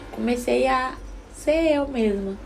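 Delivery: ordinary talk, nothing marked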